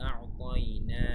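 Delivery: আ'তাইনা slow and loud, final না lengthened; one-alif stretch. Shown as a demonstration. Teacher shows students one-alif stretch on না, lengthened আ'তাইনা. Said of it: "নাতে এক আলিফ টান".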